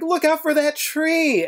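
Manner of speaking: high, drawn-out cartoon warning call; quoted warning cry. Cartoon character warns of a tree ahead, high mock cry. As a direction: stretch it out high, calling a warning. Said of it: "He's like".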